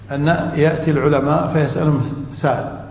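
normal talking voice

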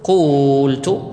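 قلت held very slowly before the و is dropped; teacher sounding out قلت step by step; slow demo pronunciation. Shown as a demonstration. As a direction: stretch قلت slowly for the students. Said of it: "فتقول".

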